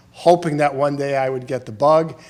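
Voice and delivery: ordinary talk, nothing marked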